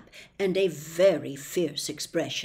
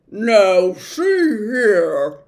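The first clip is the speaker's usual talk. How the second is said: loud dog growl of protest; growling angrily; 11 dB louder, much slower than usual. Acted. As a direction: push back gruffly. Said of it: "growled Tock angrily".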